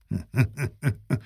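a quiet laugh turning to tears; soft laugh. Acted. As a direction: let a soft laugh slip out before the words.

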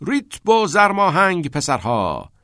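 slow, high, loud coach's call; shouting over music. A narrator's reading. Keating urges the boys on over loud music, shouting. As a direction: call out over a blaring record. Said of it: "کتینگ از ورای صدای بلند موزیک فریاد زد".

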